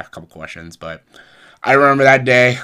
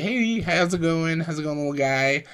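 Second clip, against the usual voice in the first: friendly big guy greeting; 9 semitones higher than usual. Retold or imitated. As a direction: greet a shy kid brightly. Big Show greeting a shy kid.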